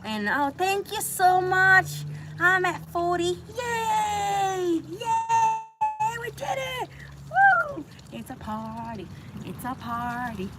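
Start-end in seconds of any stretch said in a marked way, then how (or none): 3.6-7.8 high, whooping celebration; excited cheer. Real, not acted. Cheering viewers for reaching forty, high and excited. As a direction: cheer like you just hit a goal.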